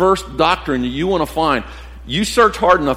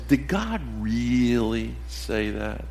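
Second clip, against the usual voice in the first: tempting whisper; 3 semitones lower, 9 dB quieter, much slower than usual. Retold or imitated. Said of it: "he whispers in her ear".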